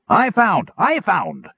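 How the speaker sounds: very high, excited goblin babble; shrieking high with glee over the find; babbling goblin. A narrator's reading. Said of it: "babbled the goblin".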